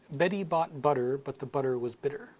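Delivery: American accent, T's tapped like D's. Shown as a demonstration. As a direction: read the tongue twister in an American accent. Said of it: "in my American accent".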